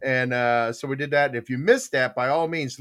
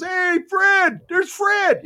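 high, loud delighted hail; acted calling out; 6 dB louder, much slower than usual. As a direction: hail a pal across a stadium. Tom calls up to the stands.

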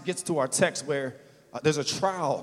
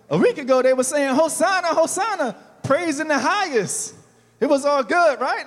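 much higher, louder, fired-up praise; fervent preaching; 9 semitones higher, 7 dB louder than usual. Real, not acted. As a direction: lift the church with a joyful cry. Excited preaching climax.

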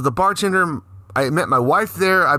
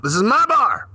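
acted indignation; 6 semitones higher than usual. Retold confrontation with grifters.

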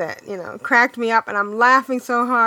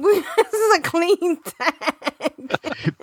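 slow, high, giggly objection; words drawn out through laughter; laughing protest; 5 semitones higher, much slower than usual. Real, not acted. Laughing after a crude joke.

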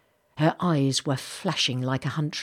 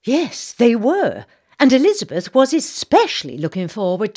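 high, loud, indignant Southern lady; genteel outrage, fanning herself; Southern belle gossip; 7 semitones higher, 9 dB louder than usual. Acted. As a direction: play the scandalised society matron. Missus Bartlett replies.

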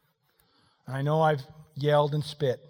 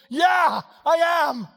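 loud, high, emphatic mock reply; hammed-up domestic shout; acted bossy comeback; 12 semitones higher, 11 dB louder, slower than usual. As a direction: assert yourself as the boss, playing it big. Acted boss's answer, shouted.

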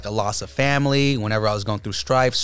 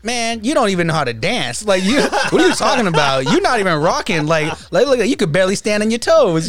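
teasing trash talk, louder; mocking Abe; 7 semitones higher, 4 dB louder than usual. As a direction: roast him in a mocking voice. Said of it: "Abe's just like".

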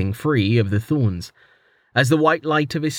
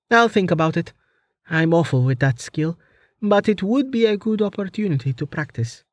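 put-on higher, lighter young woman's voice; teasing young woman, higher pitched; Amelia's voice; 8 semitones higher than usual. A narrator's reading. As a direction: voice the heroine a step above your own.

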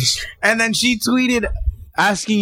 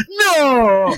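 a loud, higher cry of dismay; mock anguished no; 7 semitones higher, 4 dB louder than usual. Picturing listeners hearing the spoiler, a hammed-up anguished cry. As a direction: howl as if the movie got spoiled. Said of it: "ten people listening to this going".

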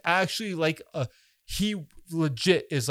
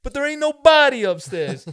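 higher and louder mock Hollywood Italian accent; 9 semitones higher, 7 dB louder than usual. Quoting the bar scene from Out for Justice, in a loud mock Hollywood Italian accent. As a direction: play the tough guy with a hammy Hollywood Italian accent. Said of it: "the overly Hollywood Italian accents".

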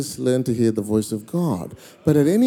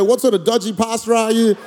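higher-pitched, indignant scolding; worked-up mock outrage; imagined outraged rebuke; 8 semitones higher than usual. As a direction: voice her scolding the pastor in indignation. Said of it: "stand up, slap me, go".

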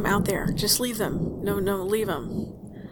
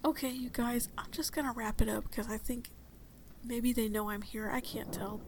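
hushed voice; 11 dB quieter than usual. Acted. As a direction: keep it down to stay hidden. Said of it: "I'm gonna be quiet".

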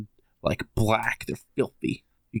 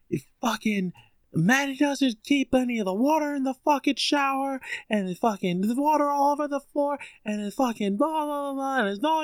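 high, mock-screamed nagging at moderate volume; retold screaming rant. Tenant imitating the landlady blaming them, mock screaming rant. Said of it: "screaming nasty".

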